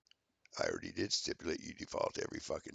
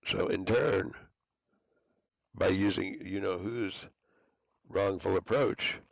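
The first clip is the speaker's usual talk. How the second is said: loud ranting threats; 7 dB louder than usual. Real, not acted.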